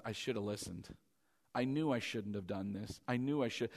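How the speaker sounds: low, soft self-reproach; quiet regret. Acted. Someone realizing they should have listened, low and quiet. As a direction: sink into hushed, rueful admission.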